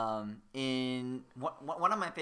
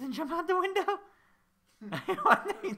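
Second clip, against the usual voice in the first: laughing; 6 dB louder, faster than usual. Real, not acted. Amused at the game scene.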